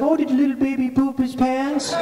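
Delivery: put-on high, cooing baby talk; mocking baby talk. Woman teasing crying Rich about his pants, mocking baby talk. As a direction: coo at him as if at a baby.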